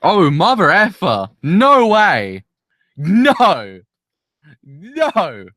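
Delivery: loud stunned disbelief; shocked outburst. Real, not acted. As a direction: cry out in shock at the twist. Shocked at cliffhanger.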